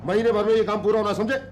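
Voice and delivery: high, loud, hurried orders; bossy shout. Acted. Site boss hurrying labourers, bossy shout. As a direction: call out sharply across the site.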